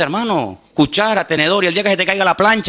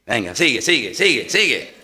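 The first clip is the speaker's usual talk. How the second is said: mock devil goading; 6 semitones lower than usual. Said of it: "el diablo dice".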